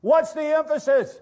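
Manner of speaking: high, loud question; emphatic repeat. Shown as a demonstration. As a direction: call the question out to the congregation.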